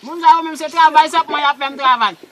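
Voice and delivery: ordinary talk, nothing marked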